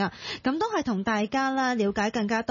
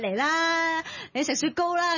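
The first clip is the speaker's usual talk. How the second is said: high sing-song coaxing; mock coaxing parent; 6 semitones higher than usual. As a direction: playfully coax a child like a toddler. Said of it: "你冇理由仲畀杯雪糕佢". (扮媽媽高聲氹細路)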